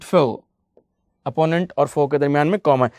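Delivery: ordinary talk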